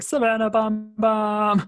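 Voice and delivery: high, slow teasing singsong. Retold or imitated. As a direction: sing the nickname to tease her. Teasing a friend with nickname.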